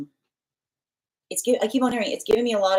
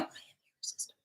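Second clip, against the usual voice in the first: hushed whisper of a boast; whispered self-assurance; 22 dB quieter, much faster than usual. Retold or imitated. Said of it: "they're whispering it like that".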